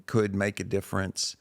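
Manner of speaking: ordinary talk, nothing marked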